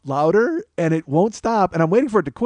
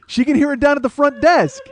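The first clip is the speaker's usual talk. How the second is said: incredulous; 13 semitones higher, 6 dB louder than usual. Real, not acted. Incredulous outburst about front desk.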